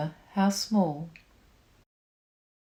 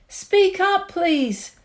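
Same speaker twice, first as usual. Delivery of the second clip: higher, louder call; 9 semitones higher, 4 dB louder, slower than usual. A narrator's reading. Horton calling down to the speck, louder plea. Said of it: "said Horton".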